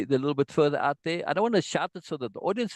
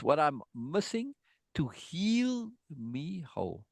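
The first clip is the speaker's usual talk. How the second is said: slow recital; 2 semitones higher, 4 dB quieter, slower than usual. Shown as a demonstration. Teacher recites lyric slowly.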